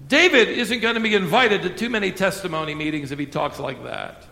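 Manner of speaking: usual speaking voice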